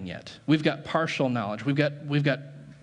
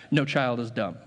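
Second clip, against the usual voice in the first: ordinary delivery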